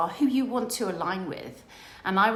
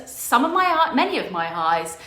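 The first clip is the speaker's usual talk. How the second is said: ordinary delivery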